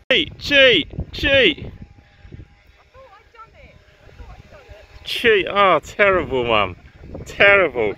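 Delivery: loud, high mock-accusing shouts; calling out. Teasing Mum for cheating, loud calling out. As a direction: call out the cheater in playful outrage.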